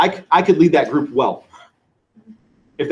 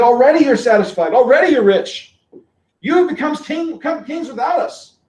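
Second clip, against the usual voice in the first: sarcastic; 9 semitones higher, 4 dB louder than usual. Retold or imitated. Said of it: "then he gets really sarcastic".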